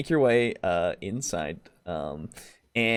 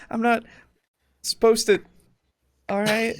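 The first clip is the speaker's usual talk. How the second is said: hesitant shopkeeper; 9 semitones higher than usual. Acted. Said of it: "she kind of is like".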